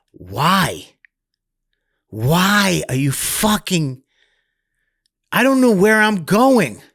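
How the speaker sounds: put-on a kid's rage thrown back at his mother; retold angry yell. Retold: kid yelling at mom in jail.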